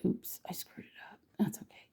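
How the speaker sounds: breathy whisper to herself after a slip; whispered aside. Real, not acted. Painter whispering to herself after smudging the canvas, hushed. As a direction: whisper it under your breath, unbothered.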